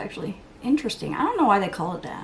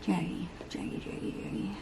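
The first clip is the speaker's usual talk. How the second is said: much softer, hushed repeated name; soft self-scold; 9 dB quieter than usual. Real, not acted. Vlogger chiding herself over too much blush, hushed. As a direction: murmur it at yourself.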